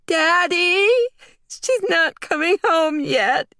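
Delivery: put-on very high, slow wailing kid; tearful child. A narrator's reading. Crying little girl tells daddy mom isn't coming, high tearful wail. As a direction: cry it out to daddy. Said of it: "Lulu burst into tears".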